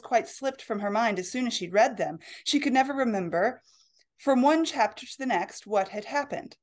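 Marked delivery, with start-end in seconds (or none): none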